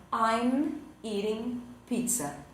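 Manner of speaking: very slow, careful English example; slow model sentence. Shown as a demonstration. Teacher showing students the gerund, slow and careful. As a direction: spell out each word slowly for learners.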